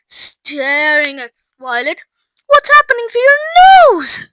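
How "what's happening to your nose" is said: very high, loud horrified question; alarmed shriek. A narrator's reading. Mother staring at Violet's nose, horrified shriek. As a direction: shriek it in panic. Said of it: "shrieked Missus Beauregard suddenly".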